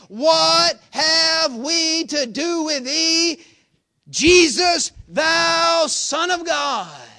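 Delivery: loud, high, long-drawn preacher's shout; crying out. Acted. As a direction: cry it out at full force, stretching each word. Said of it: "they cried out".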